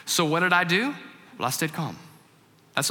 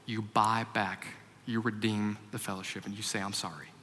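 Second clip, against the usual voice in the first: much softer, calm inner voice of God; small gentle voice; 5 semitones lower, 10 dB quieter than usual. Retold or imitated. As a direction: speak it softly, like a still small voice. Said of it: "I heard this small voice".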